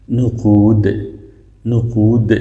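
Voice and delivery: "نقود" ending in a light d; plain light dal. Shown as a demonstration. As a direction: drill "نقود" with a d, never a heavy dad. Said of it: "دال باید مثل باد تلفظ نکنین". («نقود» با دال ساده، نه ضاد)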